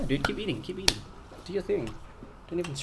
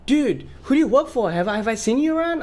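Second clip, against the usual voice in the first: high-pitched, louder imitation of an eager fan; excited stranger; 12 semitones higher, 5 dB louder, faster than usual. Audience member quizzing a supposed crew member, excited and high.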